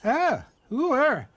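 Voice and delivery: high, slow startled cry. A narrator's reading. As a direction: cry out in alarm from the dark. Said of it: "she cried".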